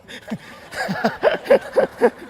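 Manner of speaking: a quick unvoiced laugh, rising; breathy laugh. Real, not acted. Preacher laughing at himself as the strict father. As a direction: laugh at yourself in the mirror.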